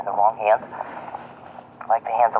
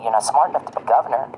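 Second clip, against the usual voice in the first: normal talking voice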